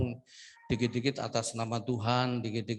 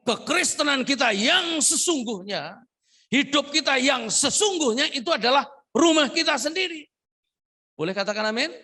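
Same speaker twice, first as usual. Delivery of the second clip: much higher, louder preaching cry; full-force preaching; 13 semitones higher, 8 dB louder than usual. Acted. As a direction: preach it with fire to the church. Preaching climax. (khotbah berapi-api dengan suara tinggi dan keras)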